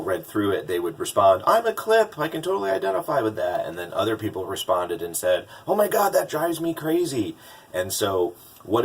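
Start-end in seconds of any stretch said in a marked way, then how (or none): 1.5-3.6 high, quick burst of recognition; mock excited reader. Voicing enthusiastic reader responses. 5.7-7.3 high-pitched imitation of an annoyed commenter; mock exasperation. Voicing exasperated reader responses.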